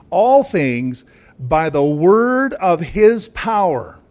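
"All things" loud and drawn out; slow, loud proclamation stretching "All things"; emphatic stress. Real, not acted. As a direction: declare it slowly, hammering "All things" and "word". Answers own question with verse.

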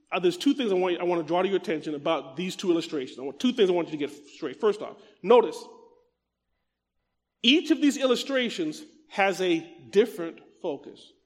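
ordinary talk

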